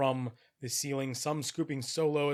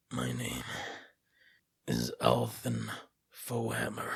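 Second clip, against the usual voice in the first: raspy whisper of a dying dwarf; guttural dwarf voice. Acted. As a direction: rasp it out like a withered corpse. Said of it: "guttural voice speaking in Dwarven".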